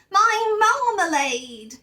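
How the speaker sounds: high, loud, slow cry of relief; overjoyed shout of relief; thankful exclamation. A narrator's reading. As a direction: greet the lost jar with joy. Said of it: "he exclaimed thankfully".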